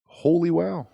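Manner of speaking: high, loud amazed exclamation; surprised. Real, not acted. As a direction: gasp it out in amazement. Reacting to the accent idea.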